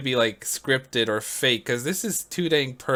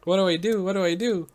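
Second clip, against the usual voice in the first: higher, imitated panic without a full scream; mock panicked screaming; 5 semitones higher than usual. Caller with an alligator on the bed, imitated panic. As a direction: repeat it in a pitched-up, hammed-up fluster.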